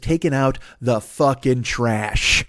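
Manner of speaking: slow, weighty emphasis on each word; drawn-out punchline. Pretend. Man gloating over an imagined power bomb, slow and deliberate. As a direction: stretch out "the fucking trash".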